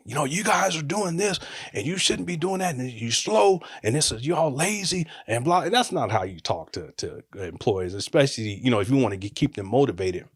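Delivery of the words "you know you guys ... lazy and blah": mock boss rant. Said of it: "just going off on everybody".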